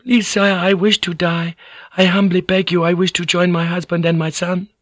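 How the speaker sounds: high, meek feminine plea; high-pitched submissive entreaty; humble beseeching. Acted. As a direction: beg formally in a high voice. Woman begging her lord for death.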